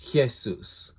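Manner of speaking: "Jesus" slowly as hyeh-SOOS; "Jesus" as Hyeh-soos, drawn out; guttural Greek pronunciation. Shown as a demonstration. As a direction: say "Jesus" with a throaty Greek h and long oo. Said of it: "It's pronounced".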